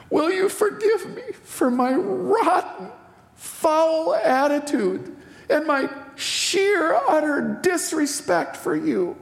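high, voice breaking with tears as he retells asking; retold apology to his father, tearful and cracking; tearful plea. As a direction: choke up while pleading for forgiveness. Son begging father's forgiveness.

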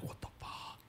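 voiceless, almost silent disbelief; whispered what the fuck. Retold or imitated. Shutting the door on the sleeping intruder, a panicked whisper. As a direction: panic under your breath.

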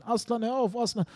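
high mock scream at the dog; son's scream. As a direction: squeal it out like a little boy. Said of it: "Und dann hat er geschrien".

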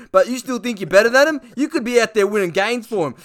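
high, loud hammed-up footy bloke; Duck's voice. Duck needling Rance about the Richmond boys, high and loud. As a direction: pitch it up and bark it like a wound-up player.

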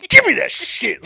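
mock furious mother; angry snatch. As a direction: bark it while snatching the gift back. Mom snatching the gift.

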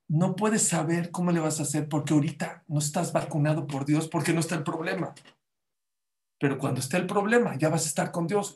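ordinary talk

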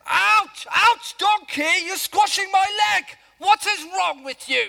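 pained yelling. Acted. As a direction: yelp as your leg gets crushed against the wall. Donkey squashes Balaam's leg.

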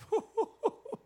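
short laugh. Real, not acted. Laugh after reading.

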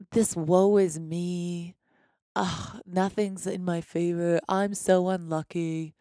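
slow, mopey donkey moan; Eeyore voice. Retold or imitated. Mocking a self-pitying listener in a mopey Eeyore voice. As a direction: drag it out like gloomy Eeyore. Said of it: "That's my Eeyore voice".

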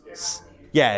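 usual speaking voice

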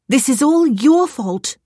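high, slow scolding with heavy emphasis. A narrator's reading. Stranded woman blaming a cat, scolding.

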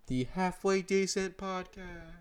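high, slow drawn-out show title; sing-song, slowed-down podcast sign-off; playful announcer. Pretend. Sign-off announcing the show's name.

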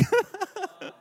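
loud high laugh; real high-pitched laugh; high burst of laughter. Real, not acted. Laughing at the irony.

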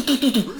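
high hammering "tu tu tu"; imitated typewriter pounding. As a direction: fire off high keystroke noises. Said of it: "Do toho pořád mlátila". (napodobené bušení do kláves)

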